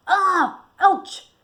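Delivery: sharp, high cry of pain. A narrator's reading. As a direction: yelp from a sudden stab. Spear stuck into his calf.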